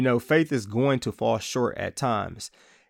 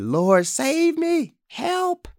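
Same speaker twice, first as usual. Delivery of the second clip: much higher, slow, pleading "Lord, save me, help"; desperate cry for help; much slower than usual. Retold or imitated. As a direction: cry out like a man going under the waves. Peter sinking, crying out.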